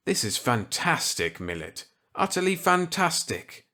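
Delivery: put-on eager reply to an old colleague; Walker's voice. Walker accepting Millet's gambit eagerly.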